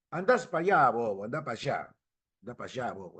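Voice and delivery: imitación ligera de acento argentino; throwaway Argentine accent with sh-sounding "allá". Retold or imitated. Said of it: "Es decir, argentino".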